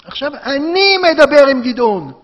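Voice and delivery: loud, slow, high proclamation; God taking over. Acted. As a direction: command the messenger aside with full authority. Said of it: "כביכול אלוקים אומר למלאך". (הכרזה רמה וסמכותית)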